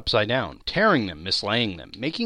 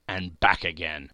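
plain reading voice

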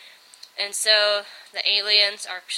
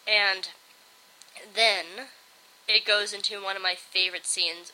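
ordinary delivery

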